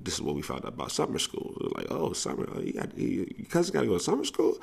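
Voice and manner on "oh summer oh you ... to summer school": high, fast mock amazement; mock surprise. Teasing the gangster about summer school, high mock surprise. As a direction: squeak it out in fake disbelief. Friends mocking surprise at cousin.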